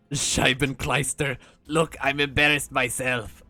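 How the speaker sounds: clumsy mock German accent; bad German accent. [name] embarrassed by his acting, in a bad German accent. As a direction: have a shot at German, badly faked. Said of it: "that wasn't German".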